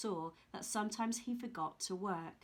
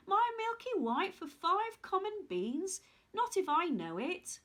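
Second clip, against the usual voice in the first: indignant refusal; 7 semitones higher, 3 dB louder than usual. Acted. Jack refuses the beans.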